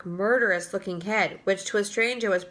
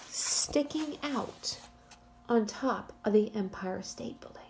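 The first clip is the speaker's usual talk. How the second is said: hushed, secretive whisper; 8 dB quieter than usual. Shown as a demonstration. Teacher whispers to build suspense.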